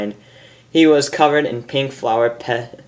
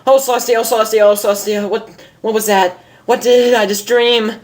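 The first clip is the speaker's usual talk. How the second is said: high, loud, breathless panic; frantic pony just woken from a nightmare; panicked; 10 semitones higher, 4 dB louder, faster than usual. Acted. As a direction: gasp it out, wide-eyed and shaken. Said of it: "eyes wild".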